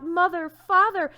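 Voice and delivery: high, loud cry to his parents; calling out with all his heart; wanting to shout. A narrator's reading. Donkey calling to his parents, loud and high. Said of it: "How he wanted to shout".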